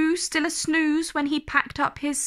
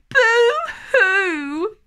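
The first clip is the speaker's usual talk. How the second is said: much higher, louder, hammed-up boo-hoo wail; mock crying; 8 semitones higher, 7 dB louder than usual. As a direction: mimic the Whos bawling, mockingly. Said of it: "will all cry".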